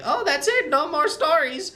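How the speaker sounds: high, put-on auntie voice. Auntie tells the kid the stories are over, teasing auntie voice. Auntie in story ends the tales.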